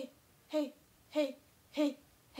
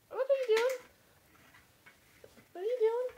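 high surprised; 10 semitones higher, faster than usual. Real, not acted. Startled at niece.

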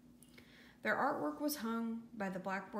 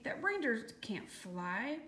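ordinary delivery

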